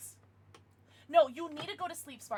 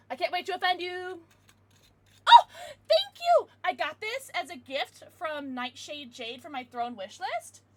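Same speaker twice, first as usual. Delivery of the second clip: ordinary delivery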